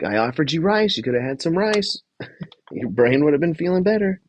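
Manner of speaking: high-pitched mock host chiding; lightly imitated host, half laughing; Jeff. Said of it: "wasn't like".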